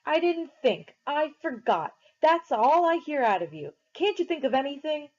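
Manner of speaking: high, loud berating; angry tirade. A narrator's reading. Derry berating Pony, high angry scolding. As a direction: lay into him.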